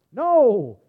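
loud, long-held exclamation; emphatic denial. Real, not acted. Preacher knocking down the imagined reply, emphatic and loud. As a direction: burst out with a flat refusal.

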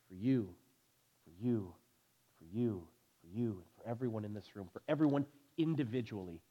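low, soft, intimate repetition; quiet, tender pulpit appeal; hushed personal address. Real, not acted. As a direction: drop to a hush, handing it to each listener. Preacher addresses listeners one by one.

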